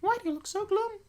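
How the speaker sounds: put-on very high squeaky girl voice; wrong character voice. Reader voices the line as the girl, very high-pitched. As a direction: squeak it out like a little anime girl. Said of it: "I know I read that completely wrong".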